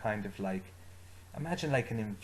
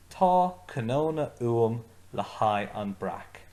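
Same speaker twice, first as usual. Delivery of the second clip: the whole Irish sentence spoken slow and a little louder; slow, emphatic model; 6 dB louder than usual. Shown as a demonstration. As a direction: lay it out slowly for the class to repeat. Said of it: "So say it with me one more time".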